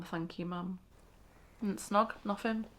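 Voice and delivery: ordinary delivery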